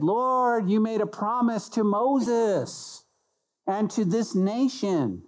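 high, drawn-out plea to God; earnest plea. Acted. Nehemiah calls on the Lord to remember his promise, earnest plea. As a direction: plead it slowly, pitched high.

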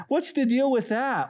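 high-pitched jeer; mocking coworker. Coworker seeing a Bible at lunch, mocking. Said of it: "coworkers that are mocking you".

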